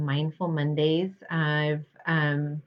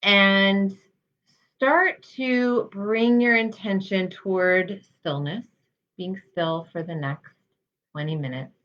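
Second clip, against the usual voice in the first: guided meditation. Real, not acted. Health psychologist guiding listeners through mindfulness practice, calm guided meditation. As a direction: lead them gently, leaving space between phrases.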